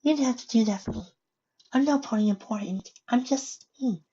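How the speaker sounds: high, soft shy pony voice; small timid mare voice; Fluttershy voice. Acted.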